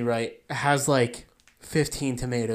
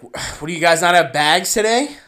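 retold yell; 5 semitones higher, 7 dB louder than usual. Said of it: "yelled over to the seventeen-year-old girl".

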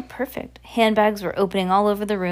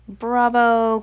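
moderately loud cheer; a bit higher and louder, acted cheer; crying out; 5 semitones higher, 6 dB louder than usual. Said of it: "cried the witches".

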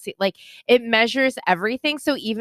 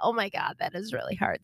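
much softer, sinking dismay; deflated reaction; 9 dB quieter than usual. Retold or imitated. Seeing the weight on the scale, deflated. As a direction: drop to a small, stunned voice. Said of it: "I was like".

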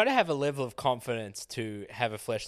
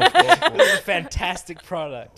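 mock ad pitch; 6 semitones higher, 11 dB louder, slower than usual. Mock product endorsement.

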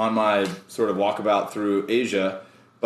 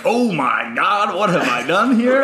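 laughing dismay; 7 semitones higher, 4 dB louder, slower than usual. Retold or imitated. First day overwhelm.

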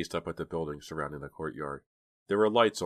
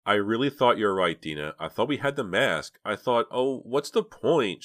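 higher, louder bitter outburst; 6 semitones higher, 6 dB louder than usual. A narrator's reading. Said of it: "she asked bitterly".

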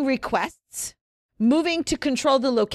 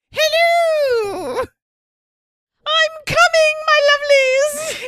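high, loud, sing-song call to the listeners; a hammed-up cooing greeting; sing-song hello; 7 dB louder, much slower than usual. Host calling out to her lovely listeners, high and sing-song. Cheery greeting at podcast open.

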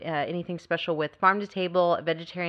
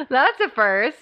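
louder burst of giggles; laughing; 8 semitones higher, 5 dB louder, slower than usual. Real, not acted. Laughing after snorting.

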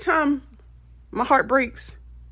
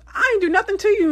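indignant protest; 9 semitones higher than usual. Retold or imitated. Voicing someone wrongly blamed.

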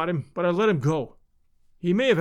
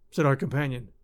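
usual speaking voice

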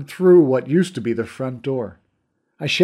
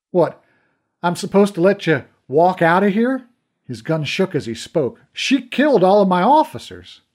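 louder, higher, disbelieving; outraged protest; 5 semitones higher, 5 dB louder than usual. Acted. Detective protesting to Connor, loud and outraged. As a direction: push back in shock. Shaken cop protests angrily.

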